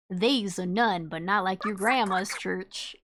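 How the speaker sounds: playful Southern cowboy read; put-on Southern twang of a cowboy; Southern cowboy accent. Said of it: "We're talking like a cowboy".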